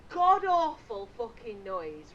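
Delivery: slow, loud, comic outrage; exasperated. Retold or imitated. Telling of being woken, exasperated. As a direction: lean heavily on each word in mock fury.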